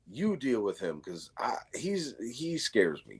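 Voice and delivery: hushed, fading to a near whisper; timid, passing it off. Retold or imitated. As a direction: imitate a scared man backing away, slowing down. Owner voiced as scared, passing him off.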